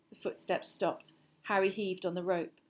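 usual speaking voice

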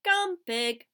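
loud, high coaxing call; calling a pig. A narrator's reading. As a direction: call the pig over to the pail.

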